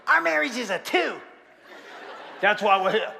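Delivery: hammed-up Southern punchline; Southern accent. Husband quotes his wife's verdict, Southern accent. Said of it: "That's how he talked".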